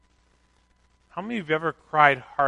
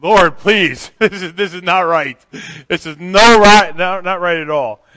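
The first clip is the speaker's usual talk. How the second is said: loud, higher, laughing through a pleading prayer; laughing mock prayer; 4 semitones higher, 14 dB louder, slower than usual. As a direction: beg the Lord while cracking up. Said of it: "It's like".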